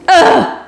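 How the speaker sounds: high, sudden 'ugh'; imitated pained groan. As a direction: groan while hanging there in pain.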